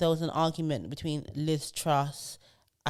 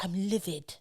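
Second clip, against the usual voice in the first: posh MP. Retold or imitated. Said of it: "video of that M P who was like".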